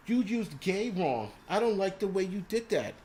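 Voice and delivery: exaggerated high-pitched imitation of a complaint; mocking a complaining viewer. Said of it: "she's like".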